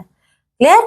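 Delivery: normal talking voice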